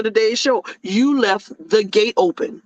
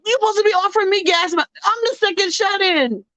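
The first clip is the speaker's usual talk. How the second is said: exasperated rant; 8 semitones higher than usual. Acted. Complaint to people calling her.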